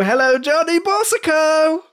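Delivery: high-pitched game show welcome; sing-song, hammed-up greeting; showy introduction. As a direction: call the name out high like a host. Host calls out a big introduction.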